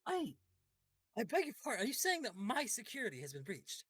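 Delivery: high, affronted lord; offended noble. Acted. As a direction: act deeply insulted at the suggestion. NPC offended at his guards questioned.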